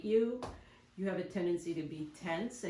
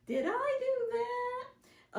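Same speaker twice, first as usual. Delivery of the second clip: much higher and slowly drawn-out goofy voice; slow sitcom catchphrase; much slower than usual. Retold or imitated. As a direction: stretch each word like the sitcom nerd. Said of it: "old T V show".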